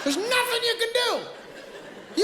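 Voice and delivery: high, drawn-out wail; comically helpless high-pitched complaint; helpless exasperation. Acted. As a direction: stretch it out in mock despair.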